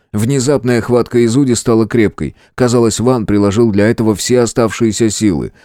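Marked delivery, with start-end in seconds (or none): none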